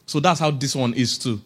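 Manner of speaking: ordinary talk, nothing marked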